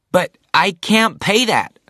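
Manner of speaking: high, loud, slow protest; dismayed cry of protest; cried out. A narrator's reading. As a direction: blurt it out in dismay. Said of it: "cried Zachary".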